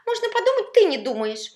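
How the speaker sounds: very high, loud, hurt retort; squeaky, aggrieved comeback; offended. A narrator's reading. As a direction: fire back like an insulted child. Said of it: "Обиженно прозвучало в ответ".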